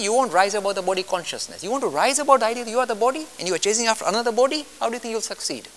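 ordinary delivery

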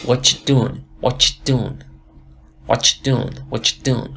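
"What you doing" run together as "wacha doin"; linked t-y sound. Shown as a demonstration. Teacher showing students how t meets y, blended ch sound. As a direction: say "What you" as one word with a ch, "wachoo".

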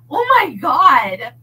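loud real laughter through the words; laughing. Real, not acted. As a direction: lose it laughing, then slow down. Reader cracks up laughing.

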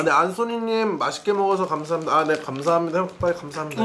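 chewing between words; eating pie while speaking; talking with mouth full. Real, not acted.

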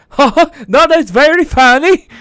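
high, loud put-on Japanese accent. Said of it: "He's doing a Japanese accent".

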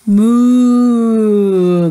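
loud, drawn-out cow moo. Acted. Teacher reading to kids, loud cow moo.

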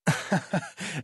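short, dismissive laugh; a quick contemptuous chuckle; scornful laugh. Acted. Legion officer scoffing at the courier's accusation, short scornful laugh.

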